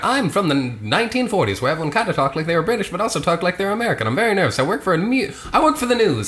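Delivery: high, fast 1940s newsman voice, half British, half American; Dapper Dan impression. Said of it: "do your best Dapper Dan".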